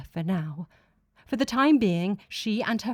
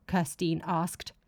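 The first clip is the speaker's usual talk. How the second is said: ordinary delivery, nothing marked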